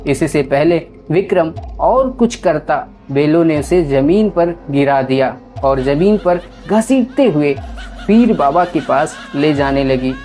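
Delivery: usual speaking voice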